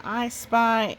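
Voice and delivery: ordinary delivery, nothing marked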